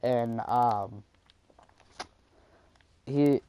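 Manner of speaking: ordinary talk, nothing marked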